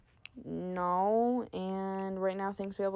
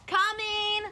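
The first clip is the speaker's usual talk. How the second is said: high-pitched call to another room; high, drawn-out holler; 13 semitones higher than usual. Real, not acted. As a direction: call out that you're on the way. Calling out to someone off-mic.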